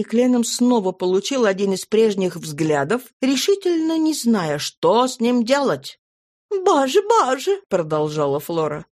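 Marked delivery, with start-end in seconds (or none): 6.5-7.6 high, fluttery chatter; gushing lady. Acted. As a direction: gush like a flustered old flame. Flora exclaims.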